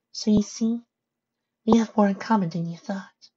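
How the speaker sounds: high, gentle storytelling character voice. Acted. Pony telling Twilight of Heimdall, high gentle voice. Pony makes her point.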